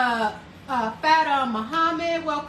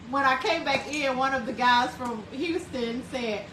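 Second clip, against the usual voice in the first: ordinary talk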